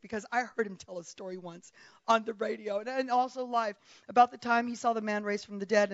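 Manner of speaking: ordinary delivery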